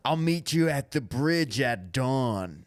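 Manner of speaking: Rick. Acted. Host imitating Rick's radio speeches, slow mock-solemn monologue. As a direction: deliver each word slowly with mock gravity. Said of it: "Whatever Rick says repeatedly".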